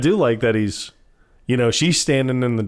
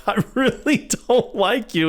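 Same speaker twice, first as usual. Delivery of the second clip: high, slow mock confession; drugged confession; 11 semitones higher than usual. Woman on truth serum blurting at him, mock confession. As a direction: draw it out, pitched way up.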